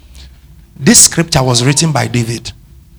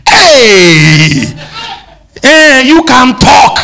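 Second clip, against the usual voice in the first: much higher, louder call to the crowd; 9 semitones higher, 4 dB louder, much slower than usual. Real, not acted. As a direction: shout out to wake the congregation. Preacher calling out to congregation.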